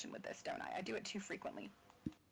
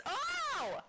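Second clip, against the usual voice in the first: surprised oh. Real, not acted. Reacts to chat message.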